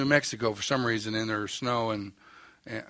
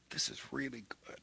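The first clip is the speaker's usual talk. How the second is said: breathy whisper, savoring every word; 14 dB quieter than usual. Retold or imitated. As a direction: whisper it to yourself as if tasting the coffee. Savoring coffee, ignoring the man.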